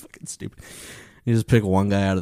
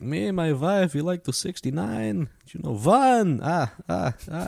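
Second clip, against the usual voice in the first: high-pitched cheesy vampire voice; hammed-up vampire punchline; Count Hacula; 9 semitones higher than usual. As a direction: count like a corny vampire, with a staccato laugh after the number. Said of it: "dumb bit at the end was like".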